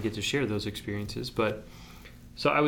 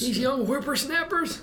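put-on high cranky old-timer voice; old prospector. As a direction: grumble like a crusty old geezer. Mocking old people.

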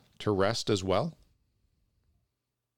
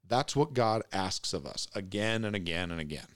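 ordinary delivery

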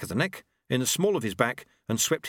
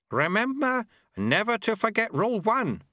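put-on a higher, sly old monk voice; old sweeper Lutze; 12 semitones higher than usual. A narrator's reading. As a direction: tease him like a cheeky old master.

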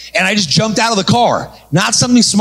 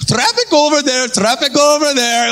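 higher, slow joyful yell; high excited shout; 9 semitones higher than usual. Retold or imitated. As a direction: call out cars like a happy traffic cop. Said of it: "The Indian guy's happy".

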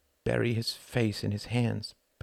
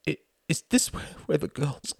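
stammering shock; 8 semitones higher than usual. Acted.

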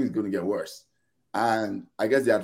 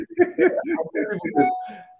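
amused high laugh. Real, not acted. Laughing at fans hyped by preseason friendlies.

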